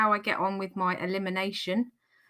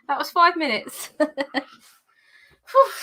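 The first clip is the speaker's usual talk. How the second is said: puffing, winded after the workout; out of breath; 4 semitones higher, 7 dB louder, much slower than usual. Real, not acted. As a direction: catch your breath between words. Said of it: "I'm actually not that out of breath".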